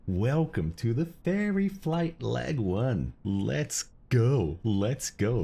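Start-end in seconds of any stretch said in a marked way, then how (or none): 0.1-3.1 slow, grand announcer welcome. Pretend. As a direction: announce it like a pilot on the PA. Announcing flight like a captain.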